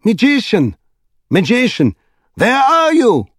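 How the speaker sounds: high, wailing calls of a frightened king; crying out, lost. A narrator's reading. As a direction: call out high for a missing guide. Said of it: "He was crying out".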